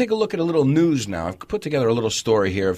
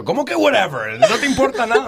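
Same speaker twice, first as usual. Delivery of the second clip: put-on girlfriend's complaint; 9 semitones higher, 4 dB louder, faster than usual. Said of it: "estaba saliendo con una chica".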